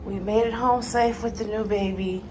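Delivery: ordinary talk, nothing marked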